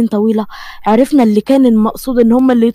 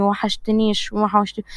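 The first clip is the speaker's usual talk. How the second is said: soft admission; 2 semitones lower, 7 dB quieter than usual. Real, not acted. Quiet admission.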